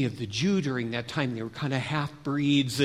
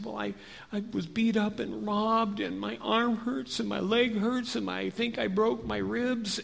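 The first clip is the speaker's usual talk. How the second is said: higher, softer, plaintive list of hurts; pained explanation; 5 semitones higher, 4 dB quieter than usual. Acted. Beaten man tells the Samaritan his injuries, pained.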